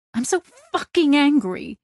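pitched high, anguished anger; so angry. Acted. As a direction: let the anger break out. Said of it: "placing her face in her hands".

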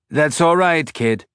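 put-on robot with a light Belter accent; tinny Belter accent. A narrator's reading. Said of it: "said in a tinny belter accent".

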